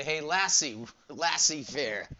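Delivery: Lassie fair said like the dog's name, slowed down; joking mispronunciation. Shown as a demonstration. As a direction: pun laissez-faire as Hey Lassie, Lassie fair. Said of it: "I say it with a Hayward accent".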